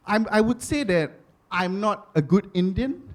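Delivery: usual speaking voice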